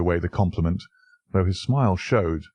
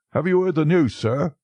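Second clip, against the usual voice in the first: higher, louder breathless alarm from the butler; gasped news; 9 semitones higher, 3 dB louder than usual. A narrator's reading. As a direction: blurt it out shaken and gasping. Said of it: "he gasped".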